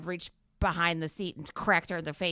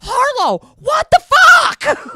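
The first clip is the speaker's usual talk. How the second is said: much higher and louder, slow shout; yelling down the hall; 18 dB louder, much slower than usual. Retold or imitated. Mom shouting down the hall at her gaming daughter.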